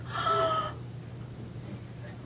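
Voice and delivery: high, long pretend gasp; shocked gasp. Teacher acting out a boy losing his cat, shocked gasp. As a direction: gasp as if your pet just escaped. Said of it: "I might be doing this".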